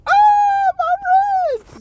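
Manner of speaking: high loud cry of pain; mock pain cry. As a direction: yelp as the ribs crack. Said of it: "He said".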